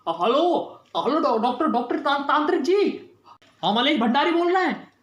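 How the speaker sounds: high, stammering plea for help; panicked urgent call. Acted. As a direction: call the doctor in a panic, pitch climbing. (घबराहट में मदद की पुकार)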